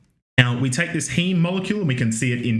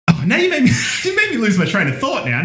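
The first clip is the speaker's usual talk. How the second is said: laughing; 10 semitones higher, 4 dB louder, much faster than usual. Real, not acted. Laughs at the chat's joke.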